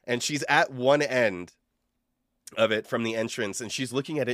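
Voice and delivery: normal talking voice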